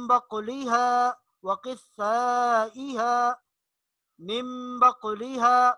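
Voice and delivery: मिम बकुलिहा slow with nasal म; slow recitation. Shown as a demonstration. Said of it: "आगे देखिए". (मिम बकुलिहा गुन्ना से)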